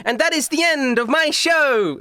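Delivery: high-pitched grand sign-off; theatrical showgirl voice; stage magician showman. Acted. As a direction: close the show with a flourish. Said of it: "she takes a bow and she's like".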